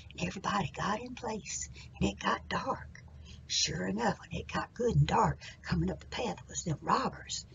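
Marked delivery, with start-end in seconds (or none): none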